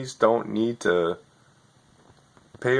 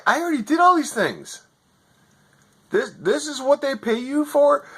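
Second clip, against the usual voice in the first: high, pitched-up furious rant; 9 semitones higher than usual. Retold or imitated. Yelling at the tech agent who couldn't fix his internet, furious. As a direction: screech at the useless agent in disbelief. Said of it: "And I was so furious".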